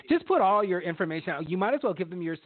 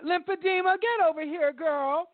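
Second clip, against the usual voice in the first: campy drag queen; 12 semitones higher than usual. Pretend. Drag queen name calling.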